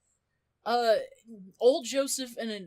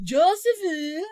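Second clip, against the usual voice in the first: slow, higher and louder, laughing; flirty drawn-out call; 7 semitones higher, 7 dB louder, slower than usual. Retold or imitated. Susie Q greeting Joseph, slow laughing flirty call. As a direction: sing out his name playfully. Susie Q swaying hips calling him.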